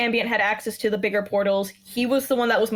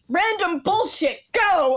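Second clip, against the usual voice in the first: comic panel battle cry; 8 semitones higher, slower than usual. Retold or imitated. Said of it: "that Moon Knight comic panel was like".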